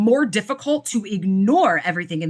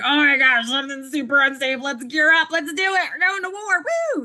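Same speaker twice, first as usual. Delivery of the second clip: climbing higher and higher into a battle cry; rising alarm that ends in a war whoop; mock panic building to a rally cry; panicking nervous system; 5 semitones higher than usual.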